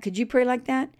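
usual speaking voice

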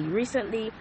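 ordinary talk, nothing marked

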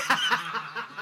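high unvoiced burst of laughter; giddy wheezing laugh; breathless laugh. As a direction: laugh it out in disbelief. Laughing after the shout.

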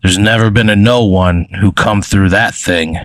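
eraser creature's put-on voice. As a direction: talk as the little coughing eraser creature. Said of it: "He goes".

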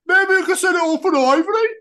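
high-pitched Birmingham accent parody; mock Birmingham accent. Shown as a demonstration. Correcting his Yorkshire slip, high-pitched Birmingham accent. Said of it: "'cause it's not like fucking this".

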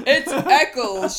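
loud, slow, drawn-out ring announcement; wrestling commentator. Acted. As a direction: boom it out like a ringside announcer. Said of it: "wrestling announcer's voice".

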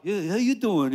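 quick squashed New York greeting; New York accent. Retold or imitated. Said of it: "But in New York".

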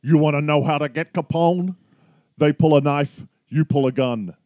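half-hearted Connery tough talk; Sean Connery impression. Quizzing his co-host with a light Scottish Connery impression. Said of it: "another quote from the same movie".

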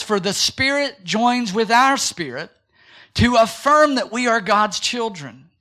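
slow, loud scripture proclamation; preacher reading the verse; emphatic scripture reading. Acted. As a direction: read each phrase slowly for weight.

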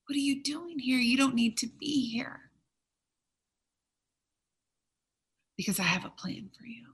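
tender whisper. Acted. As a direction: reassure him in a hushed whisper. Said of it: "He was gentle".

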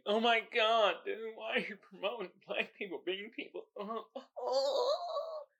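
high, soft whiny complainer; hammed-up dismayed voice, high and small; mock whiner. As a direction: imitate a fretful critic, rising into fake whimpers. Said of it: "These motherfuckers like".

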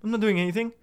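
high, loud panicky nothing nothing; defensive denial. Retold or imitated. Caught by his wife, high panicky denial. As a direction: blurt it out like a caught kid. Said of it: "I'm just like".

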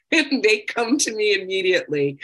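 laughing out loud through the words; a real, loud laugh as she says it. Real, not acted. Laughs at her family role.

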